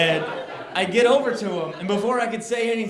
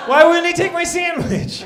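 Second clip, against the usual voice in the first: loud, high outraged yell; a comic's outraged yell; outraged shout; 12 semitones higher, 9 dB louder than usual. Real, not acted. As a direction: shout it in disbelief. Outraged rhetorical question.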